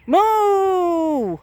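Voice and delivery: high, loud imitated cow moo; playful cow call. Shown as a demonstration. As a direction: bellow a moo at the cows. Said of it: "Got two quiet mooers".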